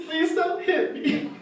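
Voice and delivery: high, drawn-out blubbering plea; comic bawling, slow and squeaky; fake crying. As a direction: beg through hammed-up sobs. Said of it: "I start crying".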